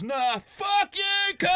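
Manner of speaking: very high, drawn-out shout; imitated fan's yell. Fan heckling him across shopping centre, yelled. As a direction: bellow it gleefully across a distance.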